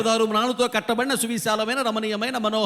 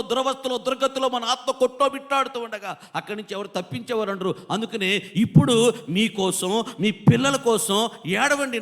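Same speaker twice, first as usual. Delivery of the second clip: impassioned preaching; 6 semitones higher than usual. Real, not acted. Preacher urging congregation to weep for their children, impassioned pleading. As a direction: preach urgently, pleading for souls.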